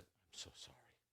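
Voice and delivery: hushed, nearly whispered imitation of grief; retold tearful apology. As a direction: barely voice the tearful man's apology. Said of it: "and he's crying".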